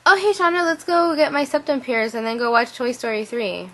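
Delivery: high, bright, bubbly suggestion; spur-of-the-moment excitement to a friend; cheery. Retold or imitated. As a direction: pitch a random plan brightly. Her own sudden cheery idea.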